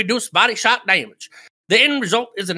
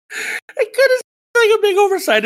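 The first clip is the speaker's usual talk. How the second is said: laughing; 11 semitones higher, 3 dB louder than usual. Real, not acted. Laughing at patch note.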